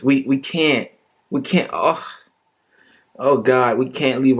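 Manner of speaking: hammed-up fake sob in the voice; mock near tears. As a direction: pretend to choke up. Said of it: "I'm on the verge of crying".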